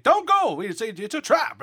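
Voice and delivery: loud, high urgent warning; urgent shout. Retold or imitated. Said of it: "telling Anakin".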